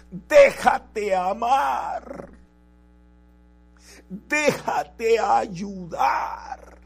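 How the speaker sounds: impassioned, slow shout of urging; loud exhortation. Real, not acted. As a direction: call it out loudly, drawing each word out. Preacher exhorts, then pleads loudly.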